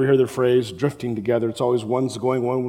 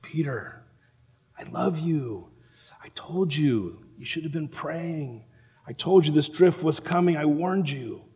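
soft, tender rebuke spoken with love; gently, like a loving teacher warning a friend; loving gentle Jesus; 3 semitones higher, 4 dB quieter than usual. Acted. Jesus looking at Peter, soft and loving. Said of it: "It was probably more with love".

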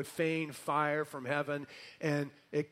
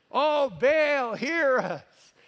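high, loud imitated plea; yelling louder; 8 semitones higher, 5 dB louder, much slower than usual. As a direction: cry out to a god who can't hear. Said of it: "You gotta yell a little louder".

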